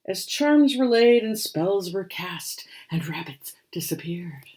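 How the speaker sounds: put-on lively read-aloud for children; rhyming story narration. A narrator's reading. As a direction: tell the rhyme with storybook bounce. Reads picture book aloud.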